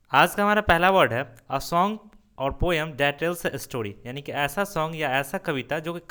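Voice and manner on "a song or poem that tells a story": slow dictation. Shown as a demonstration. Teacher reads definition aloud.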